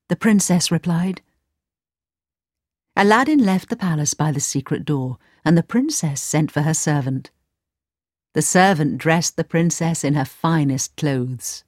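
ordinary delivery, nothing marked

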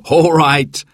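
an indulgent laugh leading into the words; laughing dad. A narrator's reading. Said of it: "laughed Dad".

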